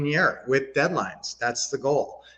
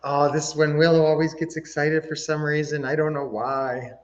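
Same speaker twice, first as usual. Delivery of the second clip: ordinary talk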